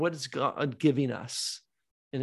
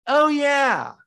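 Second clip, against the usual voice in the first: afterthought; 7 semitones higher, 10 dB louder than usual. Retold or imitated. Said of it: "It's like an afterthought".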